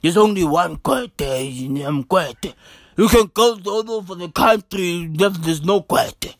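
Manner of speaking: low put-on politician's voice, self-important; Gwede Mantashe. Comedian as minister boasting he is unique, deep mimicking voice. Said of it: "He talks like that".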